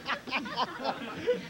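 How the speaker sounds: high rising laugh; hearty laugh. Real, not acted. Host cracking up at the guest's bit, laughing.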